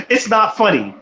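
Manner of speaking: talking through laughter; trying to be serious but cracking up; real laughing. Real, not acted. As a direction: break up laughing mid-sentence. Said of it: "I'm laughing".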